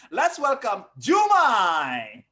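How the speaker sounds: loud, slow announcer call; host's shout. Real, not acted. Host calling the next comic on stage, slow loud welcome.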